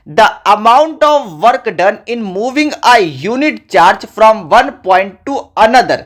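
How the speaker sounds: very slow, word by word for note-taking; deliberate dictation pace. Shown as a demonstration. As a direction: read the definition out slowly so students can write. Reading definition out for notes.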